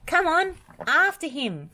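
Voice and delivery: high, loud rallying call. A narrator's reading. Wendy calls Bob to chase after the dragon, loud. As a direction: urge Bob on to give chase. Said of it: "called Wendy".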